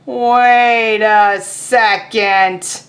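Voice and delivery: loud, then very slow suspicious halt; suspicious pigeon. Acted. Teacher voicing Pigeon catching on mid-praise, slowing down. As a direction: stop short as the trick dawns.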